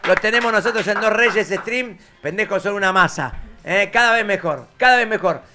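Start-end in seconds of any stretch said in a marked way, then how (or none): 0.1-2.6 very loud, high shouted thanks; rousing sign-off. Real, not acted. Host thanks the crew at show's end, shouting. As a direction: belt out each thank-you like a show's finale.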